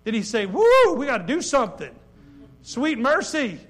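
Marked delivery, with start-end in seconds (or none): 0.5-1.9 louder, higher mock enthusiasm; mock eagerness. Preacher mocking what Moses didn't say, hammed-up eagerness. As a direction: ham up an eager hero.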